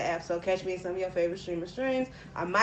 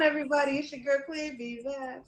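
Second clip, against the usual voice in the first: normal talking voice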